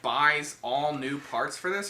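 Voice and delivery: usual speaking voice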